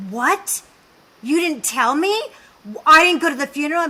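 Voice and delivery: loud, high, indignant imitation of mom; shocked outburst. Mother learns of Georgie's death from daughter, shocked imitation. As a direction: build from disbelief to a rushed loud protest.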